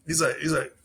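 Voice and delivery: normal talking voice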